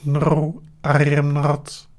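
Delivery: slow, monotone robot; Cyberman robot voice. Pretend. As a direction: deny it like a machine. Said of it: "Are you a Cyberman".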